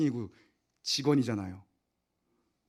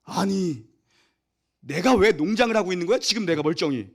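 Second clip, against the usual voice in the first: rising, louder grumbling rant; mock angry complaint; 5 semitones higher, 7 dB louder than usual. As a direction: complain about your own life choices. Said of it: "그 다음 뭐로 가요".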